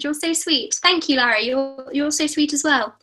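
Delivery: ordinary delivery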